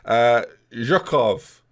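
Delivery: Zhukov drawn out slowly; careful name. Shown as a demonstration. Gamer tries a new player's name, slow and careful. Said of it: "is potentially how it said".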